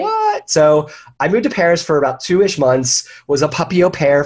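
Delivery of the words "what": very high startled what; recreating his own stunned reaction; retold disbelief. Answering husband's suggestion with a very high what.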